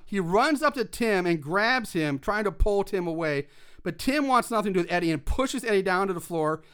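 ordinary talk

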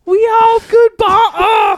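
high, loud yell. Acted. Repeating the line at the co-host, high-pitched yell. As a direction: belt it out far above normal pitch. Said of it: "You're yelling at me".

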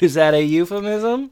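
high, teasing mimic of her question; mimicking co-host. Mocking the co-host's innuendo question, high teasing mimic. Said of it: "and you're like".

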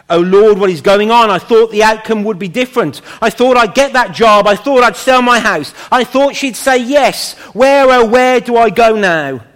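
loud, high-pitched cry of bewilderment to God; desperate plea. Acted. Preacher acting out a believer's cry to the Lord, desperate and loud. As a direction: act out a believer pleading as plans collapse.

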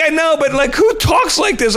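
high, slowed-down mock whine of protest; whiny protest. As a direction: whine back defensively about the script. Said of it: "I'm like".

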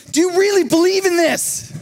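very high, intense retold challenge; heated demand. Preacher retells the manager confronting the kid in a heated moment. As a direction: act out an impassioned demand, voice pushed way up. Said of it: "it's a heated moment".

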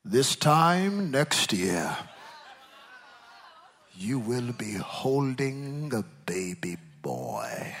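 slow, booming prophet proclamation. Shown as a demonstration. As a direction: proclaim it like an Old Testament prophet. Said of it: "Let me get my prophet, prophet voice".

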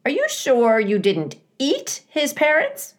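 high-pitched accusing squawk; indignant goose voice, pitched high; squawking old goose. Acted. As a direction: interrogate like a suspicious goose. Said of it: "squawking to the robot".

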